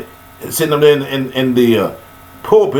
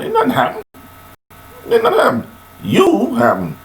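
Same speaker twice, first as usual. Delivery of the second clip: high exclaimed retort; 13 semitones higher than usual. Real, not acted. Preacher retorts to imagined question.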